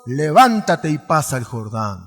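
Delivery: loud, sing-song Bible reading with music under it; preached scripture reading. Acted. Preacher reading Joshua to the congregation, loud and sing-song. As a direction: proclaim the verses loudly to the congregation.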